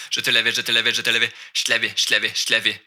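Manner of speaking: "je te l'avais" squeezed into one quick syllable; "je te l'avais" run together fast. Shown as a demonstration. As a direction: rattle off "je te l'avais" as one blur. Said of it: "Je fais juste dire ça très rapidement".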